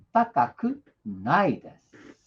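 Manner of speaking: slow, syllable by syllable; careful teacher's slow model; slow demo. Shown as a demonstration. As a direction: spell it out slowly for the student. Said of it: "Olha só como ficou diferente".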